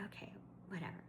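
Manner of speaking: whispered resigned okay whatever; muttered aside. Real, not acted. Fed up with store rules, whispered aside. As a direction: breathe it out under your breath.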